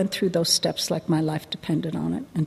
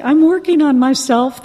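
mock self-justifying; 7 semitones higher, 9 dB louder than usual. Quoting own old excuse.

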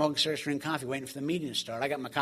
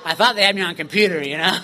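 laughing; 2 semitones higher, 6 dB louder than usual. Real, not acted. Laughing at his own naivety.

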